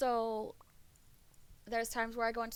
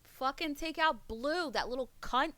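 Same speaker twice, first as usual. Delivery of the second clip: angry outburst; 6 semitones higher, 6 dB louder than usual. Real, not acted. Cursing another player.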